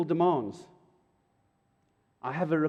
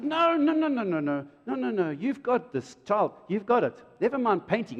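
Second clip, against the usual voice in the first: high, louder rapid dismissive no's; insistent father; 7 semitones higher, 3 dB louder than usual. Retold or imitated. Father overruling daughter's wish to paint, high insistent no. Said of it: "And I said".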